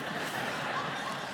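quiet breathy chuckle; soft laugh. Real, not acted. Pastor laughs at the donkey story's ending, soft chuckle. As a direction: laugh softly at the punchline.

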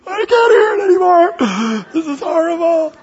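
high, loud mock crying shout; mock horrified wail. Congregation member can't bear the cat story, mock sobbing shout. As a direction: wail like an appalled listener. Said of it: "you're just like".

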